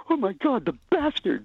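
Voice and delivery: high, gasping mock outrage from the girls; shocked girls. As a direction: squeal it like scandalised onlookers. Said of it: "and these chicks go".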